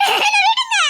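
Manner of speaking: high, desperate scream; struggling cry. Acted. Girl being dragged away, screaming to be let go. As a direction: scream to be released.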